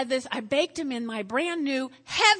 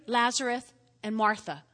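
usual speaking voice

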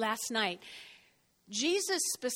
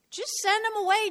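tag urgent; 8 semitones higher, 4 dB louder than usual. Acted. Voices disciples urging Jesus.

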